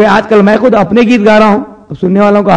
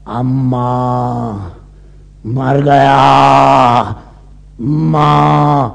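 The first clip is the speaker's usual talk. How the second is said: groaning; 8 semitones lower, much slower than usual. Acted. Man groaning, out of breath.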